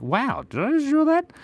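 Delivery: high, delighted gasp of wonder; amazed at own drawing. Retold or imitated. Artist amazed by own drawing, delighted high wonder. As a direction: marvel at it like a masterpiece. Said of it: "Sometimes I draw things and I'm like".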